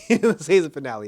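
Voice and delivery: amused laugh at her own slip; laughing through it. Real, not acted. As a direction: break into a laugh while correcting herself.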